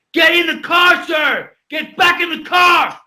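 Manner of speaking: high, loud order, slowing at the end; cop's command shout. Retold or imitated. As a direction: bark the order like a police officer. Cop ordering him back.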